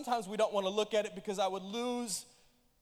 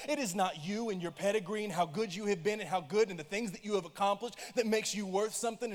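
normal talking voice

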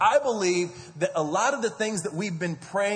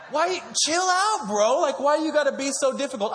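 exasperated outburst; 8 semitones higher than usual. Real, not acted. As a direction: throw your hands up at overreacting critics. Exasperated at critics.

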